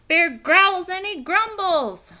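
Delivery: plain reading voice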